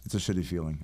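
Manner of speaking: usual speaking voice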